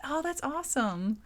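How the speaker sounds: usual speaking voice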